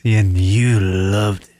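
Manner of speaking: low slow creepy echo; creep voice imitation. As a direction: mimic the creepy line. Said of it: "the creep voice".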